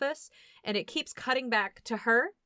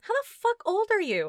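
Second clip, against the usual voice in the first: incredulous; 10 semitones higher, 3 dB louder than usual. Retold or imitated. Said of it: "And you're like".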